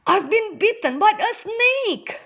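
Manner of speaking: very high-pitched alarmed cry; gasping panic. A narrator's reading. Bitten young man telling Moses, high panicked cry. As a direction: blurt it high, in pain and fright. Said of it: "The young man gasped".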